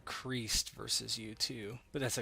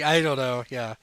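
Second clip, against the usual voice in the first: usual speaking voice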